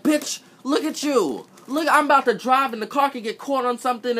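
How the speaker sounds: high, loud incredulous rant; exasperated rant. Real, not acted. Complaining about the examiner's stuck seatbelt, high and loud. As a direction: rant in disbelief.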